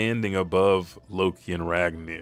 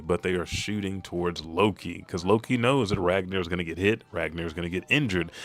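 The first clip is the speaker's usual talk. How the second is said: usual speaking voice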